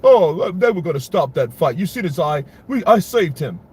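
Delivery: high, loud, boastful fighter voice; pompous boxer impression. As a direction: brag like a cocky champ. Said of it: "that's what I'm talking about. Lennox Lewis".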